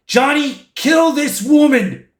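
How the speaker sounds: urgent command. Retold or imitated. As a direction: order it urgently, pitched high. Sue urgently orders Johnny.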